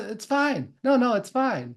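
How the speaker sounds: hammed-up cheerful denial; mock it's-fine. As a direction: insist too brightly that all is fine. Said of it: "rather than going".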